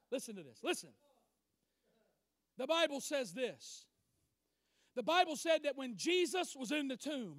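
ordinary talk

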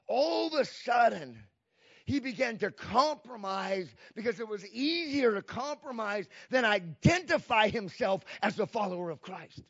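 usual speaking voice

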